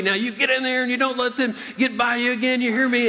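high, fast coach yell. Retold or imitated. Coach yelling at his player on the sideline. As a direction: bark the order up high and fast. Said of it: "he starts yelling at me".

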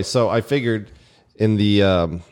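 normal talking voice

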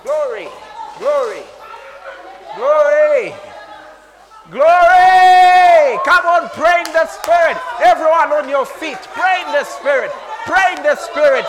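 very loud, high revival preaching; fervent praise shouting. Real, not acted. Preacher urging everyone to pray, full-force shout.

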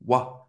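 Wa said short and low. Shown as a demonstration. Teacher isolates the wa sound. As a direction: say Wa for the French OI. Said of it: "o O I tem a pronúncia do wa".

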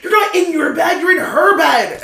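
high, loud imitated scolding; screaming at him. Girlfriend corrects Mark about whose bed, yelling. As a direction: mimic an outraged girlfriend yelling. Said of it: "she's, like, screaming at him".

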